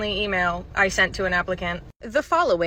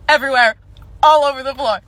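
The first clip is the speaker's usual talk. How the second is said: loud, higher horrified outburst; alarmed; 5 semitones higher, 9 dB louder, faster than usual. Acted. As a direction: punch it out in disgust. Acted alarm at dog mess.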